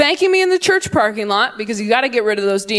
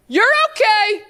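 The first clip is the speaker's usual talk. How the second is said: mock breezy parent; 11 semitones higher than usual. Parents' non-apology.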